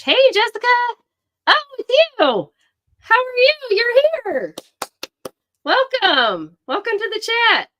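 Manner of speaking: high, loud gushing welcome; excited greeting. Real, not acted. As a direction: squeal a welcome to a friend who just joined. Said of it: "I'm so excited you're here".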